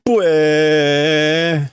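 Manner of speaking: high childish fake bawl; mock wail. Little girl bursting into cartoon tears, loud wail. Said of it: "That's her crying".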